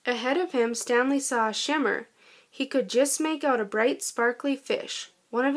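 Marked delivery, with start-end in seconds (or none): none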